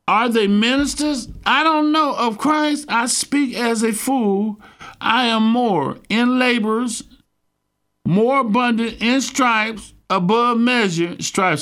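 high, slow, measured scripture declamation; scripture reading. Real, not acted. Preacher reads Paul's sufferings to his congregation, scripture reading. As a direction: read it out slowly, pitched well up.

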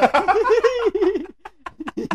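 high burst of laughter; laughing. Real, not acted. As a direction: break into a squealing laugh. Remembering the gross vomit story.

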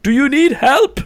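high, loud mock shout; James shouting. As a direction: imitate shouting an offer of help over chat. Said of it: "James started shouting in the chat".

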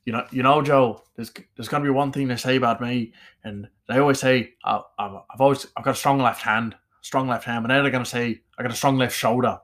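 Conor McGregor. Acted. Fighter telling Joe about his left hand, Irish accent. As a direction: boast in a punchy Irish accent. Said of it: "Irish accent is like Conor McGregor".

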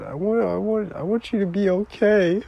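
high-pitched tearful pleading; upset, wailing appeal; real crying. Real, not acted. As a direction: plead through tears, voice lifted. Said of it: "I'm not supposed to be crying".

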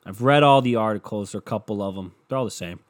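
ordinary talk

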